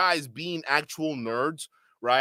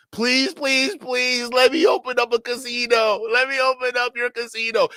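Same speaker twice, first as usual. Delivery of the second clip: high-pitched, hammed-up pleading; mock begging; 6 semitones higher, 4 dB louder than usual. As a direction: imitate someone begging desperately, voice pitched up. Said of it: "you can see how much he was begging".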